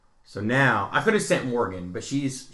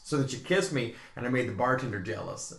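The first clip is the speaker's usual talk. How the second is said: ordinary talk, nothing marked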